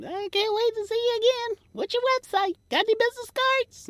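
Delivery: high, sweet gushing fan voice; mock eager fan. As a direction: falsetto gushing admirer.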